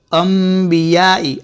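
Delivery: slow अंबियाई with an m before b; m sound. Shown as a demonstration. Quran teacher demonstrates the m sound to students. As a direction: slowly say अंबियाई as ambiyaai. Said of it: "छोटी मीम दिख रही होगी".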